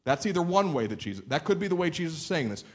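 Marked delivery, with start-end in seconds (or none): none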